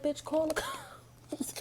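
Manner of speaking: high whispered retort with a laugh; mock whisper. Telling off someone threatening cops, laughing whisper. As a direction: hiss it back, laughing.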